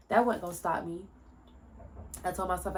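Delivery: usual speaking voice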